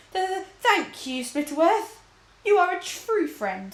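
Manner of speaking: high, slow, stammering with emotion; a grateful king choked up; deeply moved. A narrator's reading. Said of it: "deeply moved".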